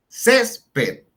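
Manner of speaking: césped with a high, loud CÉS; CÉS... ped, split in syllables; stress on penultimate syllable. Shown as a demonstration. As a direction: punch the first syllable of césped. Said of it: "llevan la fuerza en la penúltima sílaba".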